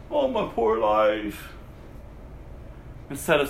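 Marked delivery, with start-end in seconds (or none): 0.1-1.5 mock whine.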